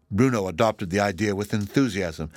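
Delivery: plain reading voice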